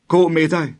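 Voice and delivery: high, loud panicked cry; desperate shout. A narrator's reading. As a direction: shout him away in desperation. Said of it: "råbte han desperat". (drengen råber desperat, højt og panisk)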